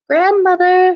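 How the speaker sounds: put-on a sly wolf squeaking like a little girl; little girly voice. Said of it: "in his best little girly voice".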